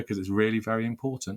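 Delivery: ordinary delivery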